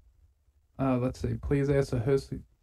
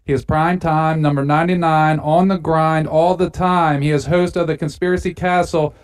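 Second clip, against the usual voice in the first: announcer; 2 semitones higher than usual. Acted. Podcast intro read.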